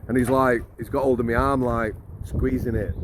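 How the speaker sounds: ordinary delivery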